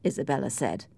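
ordinary delivery, nothing marked

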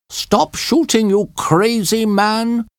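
high frantic screaming voice. A narrator's reading. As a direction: scream it at him in panic. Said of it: "Brunt screamed to von Lansen".

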